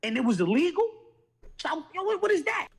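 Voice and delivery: high-pitched disbelieving questions; incredulous challenge. Acted. Acts incredulous challenge to the man.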